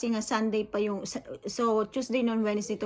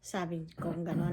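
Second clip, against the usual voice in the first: ordinary talk, nothing marked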